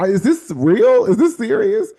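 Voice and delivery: overjoyed can't-believe-it cry; amazed disbelief. Retold or imitated. Speaker thanking God for overseas invitation, squealing in disbelief.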